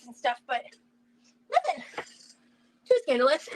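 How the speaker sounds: usual speaking voice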